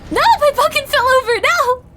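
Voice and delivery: very high, loud, frantic no-no-no; panicked shriek. Real, not acted. Player whose pumpkin fell over, panicked screaming. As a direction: scream out in alarm as it topples.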